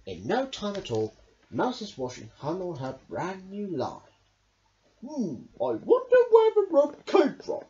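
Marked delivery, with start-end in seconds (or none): 5.0-7.6 high, loud, wondering animal voice; Badger's voice. Badger wonders about the rope, high and loud. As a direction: puzzle over it aloud as Badger. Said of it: "said Badger".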